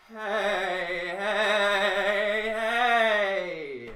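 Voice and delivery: loud, high, wobbling heys; shaken resonant voice. Shown as a demonstration. Voice coach shakes sound through arms and legs, loud wobbling call. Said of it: "through both of your arms and both of your legs".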